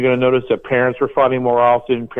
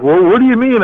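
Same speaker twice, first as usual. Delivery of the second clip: high, bewildered homeowner voice; mock confused parent asking; puzzled homeowner; 9 semitones higher, 3 dB louder than usual. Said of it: "And they're like".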